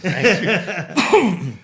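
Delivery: high, loud laughing fit; loud laughter. Real, not acted. Laughs at the science joke.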